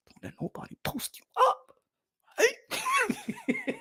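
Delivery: dad mimicking himself in a squeaky voice; high squeaky repeat. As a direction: repeat it in a silly high voice, laughing at the end. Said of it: "And I'm like".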